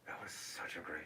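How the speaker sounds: whispered fondness. Real, not acted. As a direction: hush it fondly. Fond whisper.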